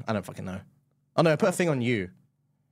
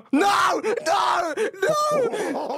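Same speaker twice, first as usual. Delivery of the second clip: high, drawn-out shouted cries; desperate refusal; 8 semitones higher, much slower than usual. Real, not acted. Losing player protesting the hit, desperate high shouts. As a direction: cry out as defeat closes in. About to lose.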